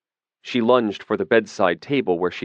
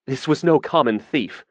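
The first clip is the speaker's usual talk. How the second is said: fretting; 6 semitones higher than usual. A narrator's reading. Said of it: "he fretted".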